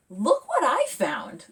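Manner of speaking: high proud announcement; excited. Acted. Zora showing off plants to her family, excited. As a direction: burst in with the find.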